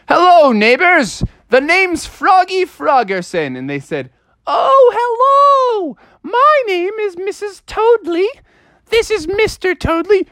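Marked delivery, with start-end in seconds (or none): 0.1-3.6 high, bright-eyed little frog greeting neighbors; cheery frog voice. Acted. Froggy introducing himself to the toad neighbors, high and chipper. 4.5-10.3 squeaky, slow, very high lady toad voice; very high mother toad. Acted. Mrs Toadley greeting the new frog, squeaky and very high.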